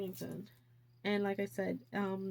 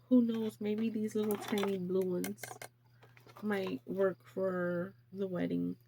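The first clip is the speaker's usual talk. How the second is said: ordinary talk, nothing marked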